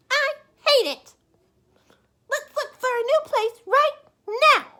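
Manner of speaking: high, loud, insistent; Missus Bird demanding. Acted. Missus Bird ordering her husband to move, high and loud. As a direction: insist on moving now.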